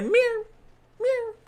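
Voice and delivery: high mewing cat sound; cat meow imitation.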